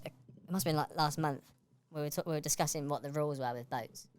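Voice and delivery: ordinary talk, nothing marked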